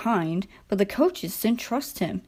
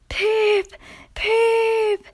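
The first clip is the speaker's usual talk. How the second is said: high, hoarse engine whistle pips; 14 semitones higher, 3 dB louder than usual. A narrator's reading. Struggling Skarloey whistling as he arrives, hoarse high pips. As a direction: toot it out hoarsely like a tired engine. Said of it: "They heard a hoarse".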